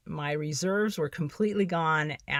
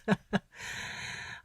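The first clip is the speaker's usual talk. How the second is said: a soft, breathy laugh at the memory; rueful laugh; 3 semitones higher, 4 dB quieter than usual. Real, not acted. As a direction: laugh it off softly, a little embarrassed. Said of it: "I can laugh about it now".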